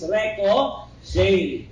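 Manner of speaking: That is ricochet said very slowly, word by word; slow term. Shown as a demonstration. Teacher naming the term for students, very slow. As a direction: spell out "ricochet" slowly for the class. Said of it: "ano yung pag-bounce ng bala?".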